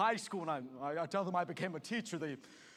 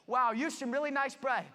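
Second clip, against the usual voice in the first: flustered slip; 9 semitones higher, 6 dB louder than usual. Acted. Nervous slip, says bread.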